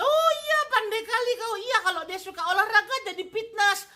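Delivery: high, loud mock delight; sarcastic praise. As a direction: gush falsely at the question. Sarcastic praise of dumb question. (pujian sarkas, tinggi dan keras)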